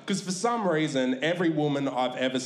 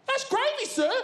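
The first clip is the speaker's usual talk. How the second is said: high, meek little answer; a nervous teen explaining; timid server. Retold or imitated. KFC girl to dad, high meek reply. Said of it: "server answers".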